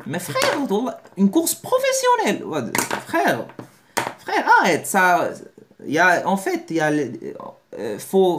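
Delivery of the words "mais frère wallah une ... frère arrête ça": squeaky high disbelief, brother come on; very high, incredulous pleading; hammed-up outraged friend; mock exasperation. Exasperated reply to the dreamer.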